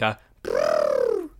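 very low, slow elephant brrrr; mock elephant trumpet rumble; imitated elephant rumble. Imitating elephant heard in building.

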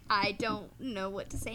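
ordinary delivery

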